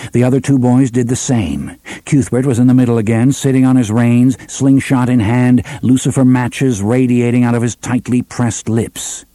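plain reading voice

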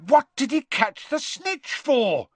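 high, slow, incredulous cry; bellow. A narrator's reading. Ron questioning the catch amid applause, bellowing. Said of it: "Ron bellowed".

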